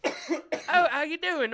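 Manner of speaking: high, lilting British greeting; mimicked British accent. Comic admits parroting people's accents, mock British. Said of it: "talks in a British accent".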